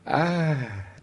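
usual speaking voice

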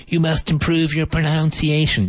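high mock teacher voice saying "pronunciation" with noun; squeaky mock teacher, wrong "pronunciation"; imitating the teacher. Learner mocking the teacher who mispronounces, squeaky high. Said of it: "if your English teacher says to you".

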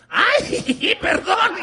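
the same fake-innocent apology, turned back on her; giggly fake sorry. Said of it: "Y yo hice lo propio". (disculpa burlona con risita)

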